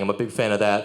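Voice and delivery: usual speaking voice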